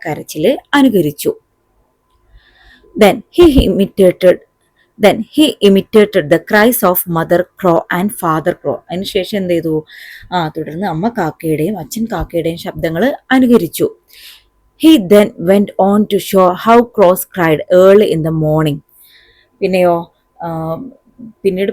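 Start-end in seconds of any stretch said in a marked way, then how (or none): 3.0-8.8 slow, deliberate English reading; measured classroom dictation pace; textbook read-aloud. A narrator's reading. Reads textbook passage aloud. 14.8-18.8 slow, firmly projected English reading; very slow, emphatic classroom reading; textbook read-aloud. A narrator's reading. Reads textbook passage aloud.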